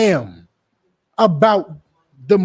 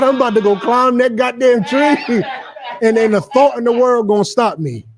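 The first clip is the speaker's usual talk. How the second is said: higher, hammed-up eager boast; mock eager boast; 5 semitones higher than usual. Preacher mocking Tory's eagerness, higher hammed-up boast. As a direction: play him giddy and sure of himself. Retelling Tory again, pitched up.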